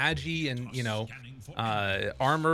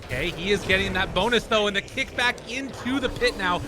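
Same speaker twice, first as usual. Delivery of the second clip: higher, louder hype commentary; excited play-by-play; 9 semitones higher, 4 dB louder than usual. Acted. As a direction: call the teamfight live, fast. Live fight play-by-play.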